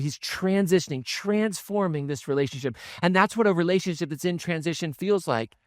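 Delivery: usual speaking voice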